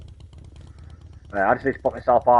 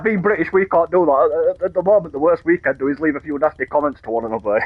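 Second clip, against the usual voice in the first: retelling the comedian's line higher and louder; mock-ranting British comic, higher and louder; mock grumpy Brit; 8 semitones higher, 7 dB louder than usual. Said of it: "He's like".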